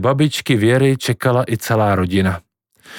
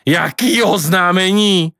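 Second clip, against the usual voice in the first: shouting; 7 semitones higher, slower than usual. A narrator's reading. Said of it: "křičel dědeček".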